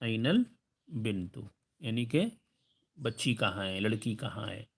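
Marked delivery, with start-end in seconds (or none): 0.0-1.4 أين البنت slowed and drawn out; slow model repeat. Shown as a demonstration. Teacher repeating for students, slow and clear. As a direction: say it slowly for learners to copy. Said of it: "girl کو کیا بولیں گے".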